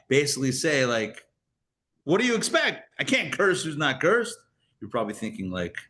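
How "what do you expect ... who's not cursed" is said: high-pitched, exasperated excuse; mock protest. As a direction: throw up your hands in hammed-up helplessness. Acting out the hired curser's excuse.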